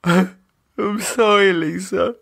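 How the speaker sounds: slow, high tearful apology. Acted. David apologising to Lisa through tears, slow. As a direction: choke out the apology.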